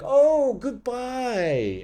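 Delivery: very high sing-song mock farewell; sneering, lilting goodbye. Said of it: "he kind of mocked me".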